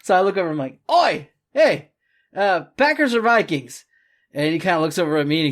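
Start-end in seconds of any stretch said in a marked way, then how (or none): 0.9-3.8 calling out. Retold or imitated. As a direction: hail the guy over your shoulder. Calling out to tattooed guy.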